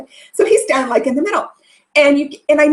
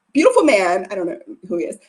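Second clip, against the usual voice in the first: usual speaking voice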